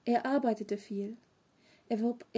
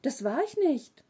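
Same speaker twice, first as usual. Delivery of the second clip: louder, higher protest; energetic denial; 4 semitones higher, 5 dB louder than usual. A narrator's reading. Accused boy to his mother, energetic denial. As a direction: protest innocence with force. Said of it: "Energisch meinte Tom".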